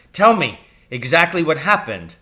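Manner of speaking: loud, high demand; angry father. A narrator's reading. Furious father demands whether Captain Lancaster did it, loud. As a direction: press him hard, angry.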